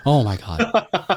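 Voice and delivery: mock disbelief, stretched out; stunned reaction. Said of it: "I was like".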